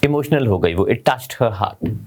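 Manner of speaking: ordinary talk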